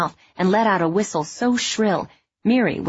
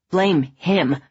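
bitter accusation. A narrator's reading. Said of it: "in a shaky voice said".

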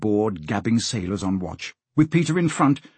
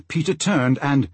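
usual speaking voice

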